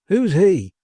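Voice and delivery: high, loud scornful question; fervent scorn. A narrator's reading. Manservant dismissing Pecksniff to his master, fervent scorn. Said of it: "in the fervour of his eloquence".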